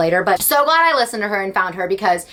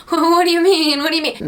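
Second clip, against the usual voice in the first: Jennifer Lawrence meme; 7 semitones higher than usual. Retold or imitated. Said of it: "Like that Jennifer Lawrence video".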